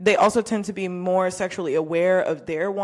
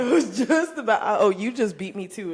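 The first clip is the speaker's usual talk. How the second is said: laughing mock quote; 3 semitones higher, 5 dB louder than usual. Mocking partner's quote, laughing.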